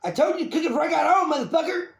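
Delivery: high, loud domestic tirade; mock furious. Woman attacking boyfriend over dinner, hammed-up fury. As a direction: rant like a fed-up girlfriend. Acts enraged woman with chicken.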